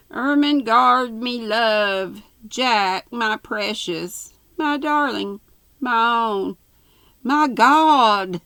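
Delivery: high, loud, swooning endearments; rapturous lovers. A narrator's reading. Jack and Ermengarde trade endearments, high melodramatic rapture. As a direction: build hammed-up passion, word by word.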